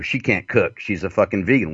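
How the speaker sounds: ordinary delivery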